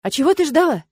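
loud, angry retort; barked. A narrator's reading. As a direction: bark the question back at her. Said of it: "рявкнул он".

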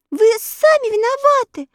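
put-on high reproachful girl; girl scolding. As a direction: reproach her like a young girl. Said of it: "начала она".

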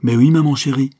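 put-on high, loud child's reply; loud, delighted kid answering; spontaneous little boy. A narrator's reading. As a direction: pipe up eagerly like a small child. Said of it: "répliqua spontanément le bambin".

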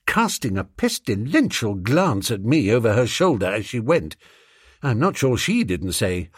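usual speaking voice

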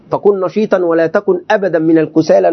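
usual speaking voice

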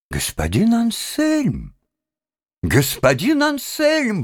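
slow, high-pitched exclamation of a name; exclaiming. A narrator's reading. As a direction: draw the name out high, as a reproach. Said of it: "воскликнул конректор Паульман". (протяжный возглас)

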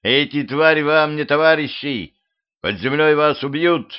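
high, urgent public warning; yelled warning. A narrator's reading. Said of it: "заорал Дюдя".

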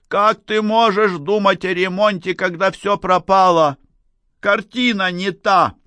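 high, loud, desperate reproach; yelling. A narrator's reading. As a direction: shout as if all is lost. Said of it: "заорала Надежда". (отчаянный крик на высоких нотах)

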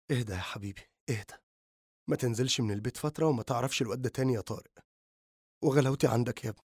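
soft, comforting hush; calm him while holding him close; soothing. A narrator's reading. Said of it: "محتضنة إياه قائلة".

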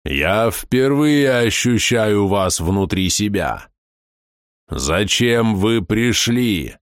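very slow, eerie creature; a vast being speaking slowly. Acted.

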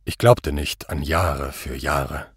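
low, slow reply; impassive accused. A narrator's reading. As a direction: answer slowly, deep and composed. Accused answers quietly.

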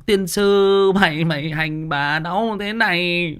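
loud, drawn-out cursing wail; mock-curse the husband through labour pain. Woman in labour cursing her husband, loud angry wail. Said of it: "nó chửi chồng".

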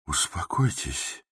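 soft, slow apologetic calming; calm him with a guilty smile; guiltily soothing. A narrator's reading. The doctor soothes the angry patient, softly and guiltily. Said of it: "виновато улыбаясь".

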